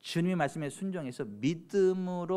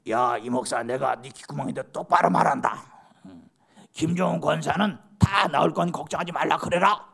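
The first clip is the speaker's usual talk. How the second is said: God; 7 dB louder than usual. Pretend. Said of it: "귓구멍에다 대고 하나님이".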